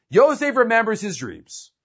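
normal talking voice

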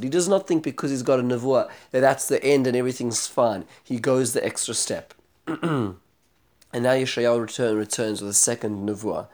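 ordinary talk, nothing marked

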